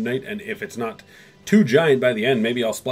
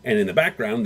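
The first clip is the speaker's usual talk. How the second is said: ordinary talk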